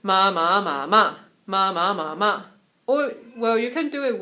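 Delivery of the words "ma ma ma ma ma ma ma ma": "ma" chanted at normal speaking pitch, firmly; tone drill in speaking range. Shown as a demonstration. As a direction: run "ma" through the tones without raising the voice. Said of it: "if I wanna do it lower, which is my speaking area".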